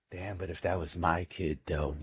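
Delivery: low, soft musing; hushed, dropped-down aside; sobered parent. Acted. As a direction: murmur it to yourself, acting troubled. Voicing parent musing at screen.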